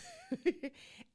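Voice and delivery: a short high burst of laughter. Real, not acted. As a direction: laugh out at your own joke. Host laughs at own joke.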